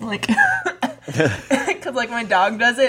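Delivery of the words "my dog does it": normal talking voice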